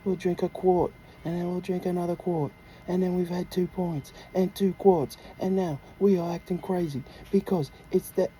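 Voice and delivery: excited mock British football fan; British accent. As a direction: spin a pub tale in a British accent. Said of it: "You like my British accent".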